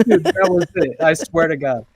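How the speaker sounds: laughing high and loud. Real, not acted. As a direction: laugh through the words. Laughing at the impression.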